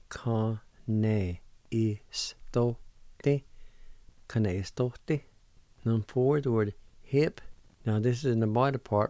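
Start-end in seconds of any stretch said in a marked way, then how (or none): 0.1-3.4 "Ka ne is to te" drawn out slowly, syllable after syllable; slow demo. Shown as a demonstration. Radio teacher to learners, very slow demonstration. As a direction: stretch each sound of "Ka ne is to te" for learners. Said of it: "It's pronounced like this".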